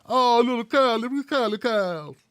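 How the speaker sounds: lightly hammed-up baby coo; mock cooing. Host mocks supporters doting on their baby Kyle, mock cooing. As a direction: fuss mockingly over a little baby.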